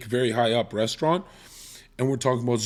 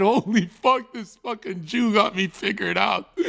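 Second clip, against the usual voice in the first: stunned exclamation; 7 semitones higher than usual. Retold or imitated. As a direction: blurt it out, floored. Retelling being stunned at dinner.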